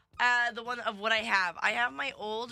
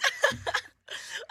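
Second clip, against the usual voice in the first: high breathy laugh. Real, not acted. Host cracks up.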